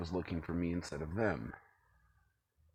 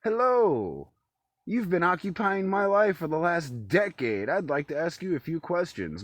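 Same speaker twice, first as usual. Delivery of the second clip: high, loud mock-polite lady voice; a hammed-up courteous stranger; 8 semitones higher, 6 dB louder than usual. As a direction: play a prim woman politely asking questions. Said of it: "walking up to me and going".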